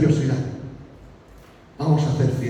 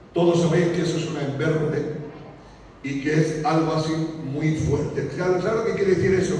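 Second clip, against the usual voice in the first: ordinary talk, nothing marked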